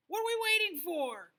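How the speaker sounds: loud, high eager cry; excited cry. Acted. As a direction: burst out with hungry excitement. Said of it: "cried the lion".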